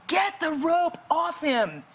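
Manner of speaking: high, drawn-out urgent order; urgent command. Retold or imitated. The Holy Spirit ordering a rescuer, high urgent command.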